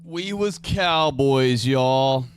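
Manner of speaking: drawn-out loud boast, slow and booming; mock cowboy boast. Cohost mocks the cowboy claim with a slow loud boast. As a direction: stretch it slowly and proudly, then bellow y'all.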